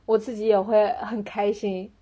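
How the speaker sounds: ordinary delivery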